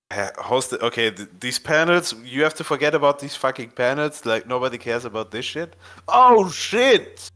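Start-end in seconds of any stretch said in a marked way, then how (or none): 6.1-7.3 high, drawn-out exclamation; real startled delight, pitched way up; excited surprise. Real, not acted. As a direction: burst out at a sudden discovery. Sudden find on screen.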